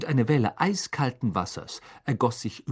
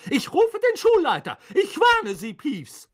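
high, loud stern teacher; angry threat; 5 dB louder than usual. Acted. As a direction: warn him sharply. McGonagall threatens Peeves. (zornige Drohung der Lehrerin, hoch und laut)

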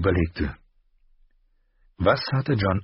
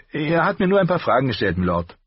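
higher, quicker voice for the apprentice; Gendry's voice; 9 semitones higher, 4 dB louder, faster than usual. A narrator's reading. Apprentice answering the Hand, higher and quick. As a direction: lift the pitch and hurry the answer.

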